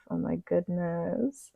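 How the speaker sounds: breathless awed whisper, slow; slow hushed oh my goodness. Real, not acted. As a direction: whisper it in awe, drawn out. Reacting to card text.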